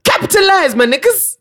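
high rallying cry of capitalize; excited rallying call. Real, not acted. Urging country to capitalize.

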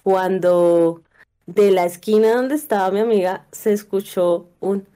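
usual speaking voice

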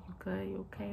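hushed, gentle check-in; soft whisper. Retold or imitated. Boyfriend whispering to wife during surgery, hushed. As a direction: whisper it softly to the wife on the table.

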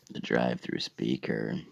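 ordinary talk, nothing marked

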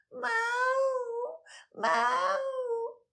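high mewing like a sad cat; sad cat meow. Acted. Unhappy cat meows. (yüksek tonlu hüzünlü miyav)

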